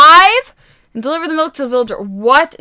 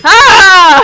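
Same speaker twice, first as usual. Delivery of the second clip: high, loud scream of glee; screaming with excitement; excited scream; 5 semitones higher, 11 dB louder than usual. Real, not acted. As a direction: let out a real scream. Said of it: "sorry if I screamed".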